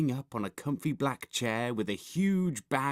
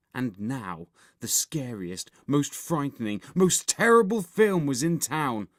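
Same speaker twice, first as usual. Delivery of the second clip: usual speaking voice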